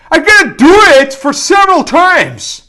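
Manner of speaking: high, loud put-on student voice; mock student. Learner acting a student asking how often, mock student voice. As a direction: play the puzzled pupil asking.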